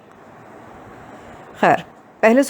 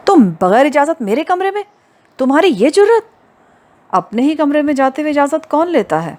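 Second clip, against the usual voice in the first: high, loud indignant outrage; furious outburst; 7 semitones higher, 3 dB louder than usual. A narrator's reading. Hoor confronts Usama barging into her room, high furious outrage. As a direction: shriek it at the intruder, outraged. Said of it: "فوراً ہی غصے سے بھر گئی".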